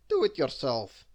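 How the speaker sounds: loud cheeky retort; defiant storybook comeback; Mister Cheeky. A narrator's reading. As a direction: answer back firmly and loudly. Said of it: "said Mister Cheeky".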